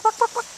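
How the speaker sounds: high-pitched playful quacks; quacking. Pretend. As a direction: quack at the chickens to lure them. Imitating a bird call.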